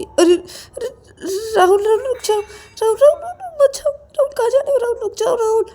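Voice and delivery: very high, loud panicked calling; frantic pleading. Acted. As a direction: call out desperately to stop someone leaving. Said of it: "वो जब जाने लगता है तो तभी रवि बोलता है कि". (घबराई, बहुत ऊँची आवाज़ में पुकारकर रोकना)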